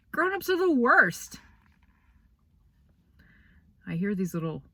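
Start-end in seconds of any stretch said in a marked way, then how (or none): 0.1-1.4 exasperated. Real, not acted. Exasperated outburst.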